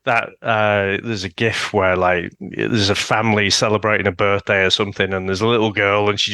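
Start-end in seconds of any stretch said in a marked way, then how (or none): none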